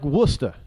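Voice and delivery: Worcester as "WUSS-tuh"; New England pronunciation. Shown as a demonstration. Citing a New England town, local-style Worcester. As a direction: drop the R's in Worcester. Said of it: "that's the way they pronounce it".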